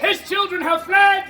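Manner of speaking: high, loud herald's cry; theatrical shout. Acted. Noble announces the children have fled, shouted. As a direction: shout the news for all to repeat. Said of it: "The noble shouts".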